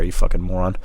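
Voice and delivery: ordinary delivery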